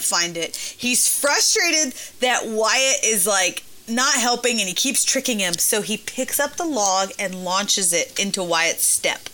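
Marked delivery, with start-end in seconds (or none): none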